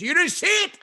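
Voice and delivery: high, loud mock outrage; retold father's yell; angry father. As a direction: demand it like an exasperated dad. Father scolds son in garage.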